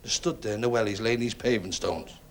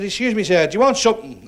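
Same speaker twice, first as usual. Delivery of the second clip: loud, raised-pitch polite enquiry; calling over politely; 7 semitones higher, 10 dB louder than usual. Acted. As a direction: call across to a gentleman in his car. Labourer approaches man in Rolls.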